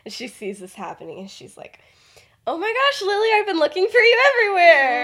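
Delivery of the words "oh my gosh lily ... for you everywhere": high, loud, theatrical relief; exaggerated urgent greeting. Dorcas breaking in on Lily in the library, hammed-up and loud. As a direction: call out brightly and far too loudly. Dorcas loudly interrupts to rescue Venus.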